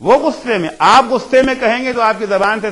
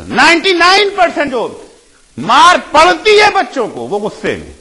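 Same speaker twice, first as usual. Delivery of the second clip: fervent preaching; 8 semitones higher, 5 dB louder than usual. Real, not acted. Preacher urging parents to wait for the right moment, fervent raised voice. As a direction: drive the point home to the congregation.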